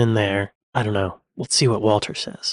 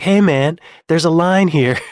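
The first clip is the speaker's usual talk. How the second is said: loud, higher call to a line-cutter; retold call-out; 6 semitones higher, 8 dB louder than usual. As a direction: call out the queue jumper, indignant. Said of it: "and I was like".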